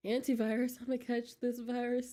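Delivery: usual speaking voice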